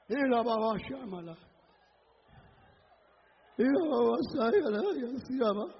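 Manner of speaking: low, soft murmured tongues; praying in tongues. Real, not acted. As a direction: sink into hushed ecstatic syllables. Praying in tongues after climax. (oración en lenguas, voz baja)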